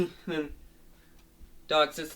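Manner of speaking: ordinary delivery, nothing marked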